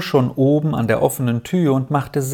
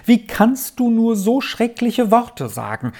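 much higher, distraught outcry; agitated cry; 10 semitones higher than usual. A narrator's reading. Aunt horrified at her brother's words, distraught. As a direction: cry out again and again. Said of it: "rief die erregte Tante".

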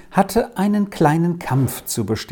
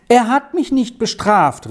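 higher, worked-up protest; agitated cry; 8 semitones higher than usual. Acted. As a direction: cry it out, still upset. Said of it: "rief Lex immer noch in großer Aufregung".

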